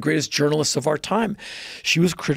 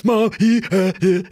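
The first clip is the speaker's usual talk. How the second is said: put-on high, louder stammer of a shaken small child; upset little boy; 5 semitones higher, 4 dB louder than usual. Boy telling his mom about the slap, stammering up high. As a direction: blurt it out like a five-year-old who just got hit.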